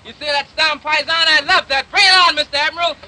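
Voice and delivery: loud, high and exultant; excited shouting. Acted. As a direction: cheer on the storm, thrilled. Shouting joyfully at storm.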